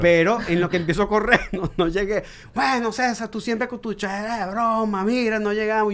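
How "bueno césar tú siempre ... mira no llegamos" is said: high mock grumbling at a joker; imitated exasperated teammates. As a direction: mimic teammates fed up with your joking. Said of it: "creían que yo estaba bromeando".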